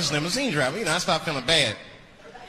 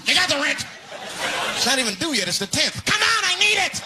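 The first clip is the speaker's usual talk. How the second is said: landlord begging; 4 semitones higher than usual. Acted. Addict landlord pressing the tenant for rent, higher pleading. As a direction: whine for the rent money.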